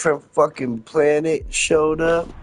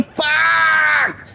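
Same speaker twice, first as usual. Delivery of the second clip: high yelled curses then a cry; mock screaming, much higher; imitated screaming; 13 semitones higher, much slower than usual. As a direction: scream it like the guy in the game. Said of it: "He's screaming".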